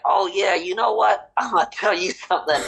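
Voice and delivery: scolding Brady wagging his finger at the press. Retold or imitated. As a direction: wag a finger while you lecture. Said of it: "is gonna be waving his finger".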